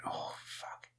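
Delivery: breathy whispered curse under breath; whispered swear. Real, not acted. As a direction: hiss it out unvoiced, to yourself.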